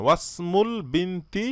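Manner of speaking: ওয়াসমুল linked, wa straight into s; no i sound in ওয়াসমুল বিনতি; silent alif. Shown as a demonstration. Teacher shows what to say, ওয়াসমুল run together. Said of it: "আপনি বলবেন".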